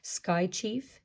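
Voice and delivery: usual speaking voice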